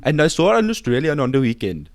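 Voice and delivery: mock Indian accent. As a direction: put on an Indian accent for the bit. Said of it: "he's gonna impersonate".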